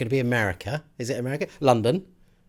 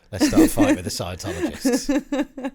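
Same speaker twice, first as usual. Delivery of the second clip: laughing; 13 semitones higher than usual. Real, not acted.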